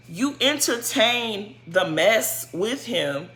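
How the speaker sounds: ordinary talk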